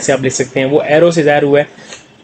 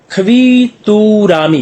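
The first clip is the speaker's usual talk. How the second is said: slow, drawn-out "खवीतुरामी", higher in pitch; syllable by syllable; 4 semitones higher, much slower than usual. Shown as a demonstration. Teacher introduces a new Korean word, "खवीतुरामी" slowly syllable by syllable. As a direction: stretch each syllable of "खवीतुरामी".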